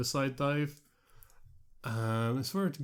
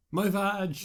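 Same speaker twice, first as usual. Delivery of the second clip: high, louder mock scream of pain; imitated scream; 8 semitones higher, 5 dB louder than usual. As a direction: cry out like a wrestler overselling an injury. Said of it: "she just starts screaming".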